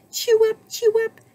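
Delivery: very high, peppy chirp; chirping cricket. A narrator's reading. Cricket popping up to join the band, high chirp. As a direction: chirp it in a tiny squeaky voice. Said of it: "chirped Cricket".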